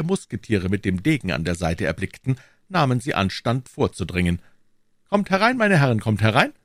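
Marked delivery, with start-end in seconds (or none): 5.1-6.5 high, loud invitation; calling out. A narrator's reading. D'Artagnan inviting the guards into his rooms, calling out. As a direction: call them in heartily. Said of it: "rief D'Artagnan".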